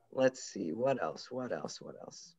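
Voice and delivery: low, fading mumble while searching; muttering to oneself. Real, not acted. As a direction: think aloud under your breath, trailing into near silence. Searching papers for next poem.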